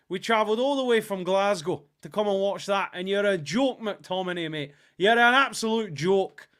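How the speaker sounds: indignant fan in a put-on Scottish accent. Shown as a demonstration. Scotland fan berates McTominay, mock Scottish accent. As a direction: rant like an aggrieved Scot, accent roughly imitated. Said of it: "I'll try and do a Scottish accent".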